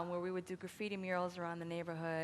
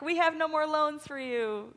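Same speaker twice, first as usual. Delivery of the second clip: slow, higher, louder mock announcement; imitated official refusal; 9 semitones higher, 8 dB louder, slower than usual. College telling her about financial aid, mock official refusal. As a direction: deliver the bad news drawn out.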